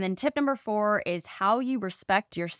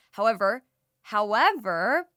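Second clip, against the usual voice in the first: "however" dicho lento y subrayado la segunda vez; stressed drawn-out however; 3 dB louder than usual. Shown as a demonstration. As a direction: stretch the second however slowly. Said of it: "reframes the entire conversation".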